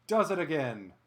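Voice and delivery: slow, high, drawn-out name, then falling; announcer's home-run salute. Pretend. Announcer hailing Munoz's homer, drawn-out proclamation. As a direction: proclaim the hitter's name with weight.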